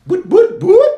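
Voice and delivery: high, loud siren imitation; siren whoop. Comic imitates the cop's siren, loud high whoops. All I heard is.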